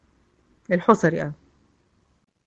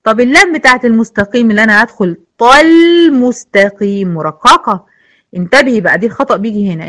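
usual speaking voice

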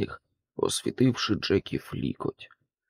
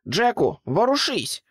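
urgent shout; 9 semitones higher than usual. A narrator's reading. Pig yelling at frozen Jack to run. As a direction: yell at Jack to move now. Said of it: "вигукнула різдвяна свинка".

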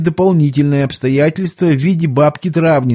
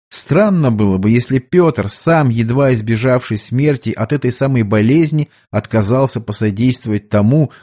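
usual speaking voice

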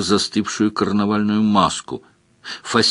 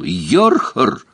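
suddenly cheerful. A narrator's reading. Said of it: "Голос Леверлина словно бы резко повеселел".

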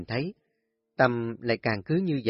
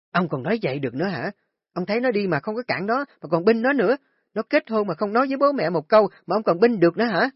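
higher and louder, rapid furious nagging; angry scolding; 9 semitones higher, 5 dB louder, faster than usual. Acted. Wife berating husband for siding with son, furious shouting. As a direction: tear into him, voice climbing higher.

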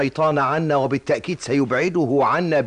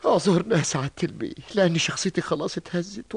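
normal talking voice

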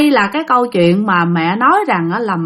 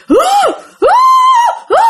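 short ghostly hoots, spaced out; eerie hú hú hú howls; imitated howl; 4 dB louder, much faster than usual. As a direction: mimic a creature's distant howling. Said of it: "nghe tiếng nó hú".

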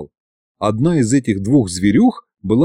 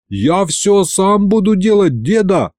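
put-on high slow solemn boyish promise; boy swears to grandpa he'll do everything; earnest vow; 7 semitones higher than usual. A narrator's reading. High earnest vowing. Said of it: "поклялся я".

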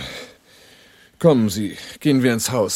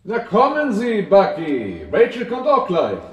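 lightly voiced, higher, coaxing menace; a hint of a menacing host; 8 semitones higher than usual. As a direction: lure him down with false kindness. Villain coaxing frightened Bucky downstairs.

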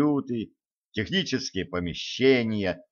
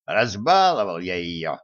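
lower, rueful dwarf grumble; a gloomy dwarf blaming himself; dejected old dwarf; 4 semitones lower than usual. A narrator's reading. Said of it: "удрученно вздохнул гном". (подавленный ворчливый гном)

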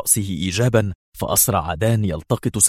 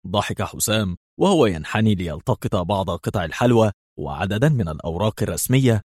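ordinary delivery

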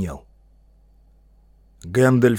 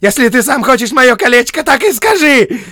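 very high, loud screech; piercing, frenzied hobbit shout; hysterical yelling; 13 dB louder than usual. A narrator's reading. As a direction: scream it, nearly choking with rage. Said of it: "Чуть не зашёлся в крике Бильбо".

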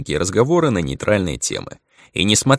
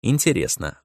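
ordinary delivery

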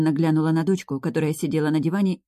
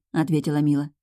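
usual speaking voice

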